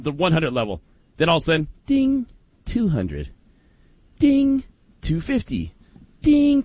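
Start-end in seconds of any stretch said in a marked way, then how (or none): 1.9-6.6 drawn-out chime imitation; elevator ding. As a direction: sing the elevator ding slowly.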